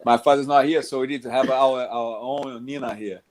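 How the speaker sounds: ordinary talk, nothing marked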